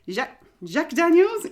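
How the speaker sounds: playful French accent on "Jacques Daniels", Daniels rising. Shown as a demonstration. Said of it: "to downdo my French accent".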